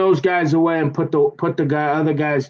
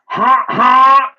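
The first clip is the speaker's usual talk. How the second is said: mock laugh; 10 semitones higher, 7 dB louder than usual. Mock laugh after complaint.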